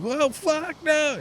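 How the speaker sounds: high, loud, strained "no!"; imitated panic. Stepmom watching the tree fall on her husband, panicked imitation. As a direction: cry out in dismay as disaster nears. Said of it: "That kind of like".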